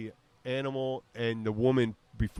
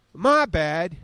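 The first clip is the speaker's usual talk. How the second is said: sheepish guy; 4 semitones higher, 8 dB louder than usual. Retold or imitated. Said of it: "And the guy goes".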